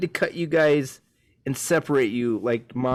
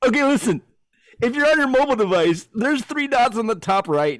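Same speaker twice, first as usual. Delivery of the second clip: bold; 8 semitones higher than usual. Real, not acted. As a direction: sell it with confidence. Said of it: "Say it with balls".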